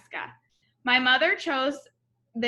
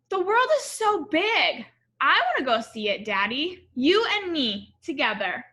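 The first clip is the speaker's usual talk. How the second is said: put-on excited little girl, higher on the opening; a small girl dreaming of travel; child voice; 5 semitones higher, 5 dB louder than usual. Child Alma voiced in story.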